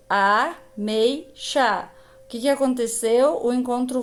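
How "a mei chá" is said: a, mei, chá separated by pauses; "a... mei... chá", slowly split; syllable by syllable. Shown as a demonstration. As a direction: sound out a, mei, chá as three beats.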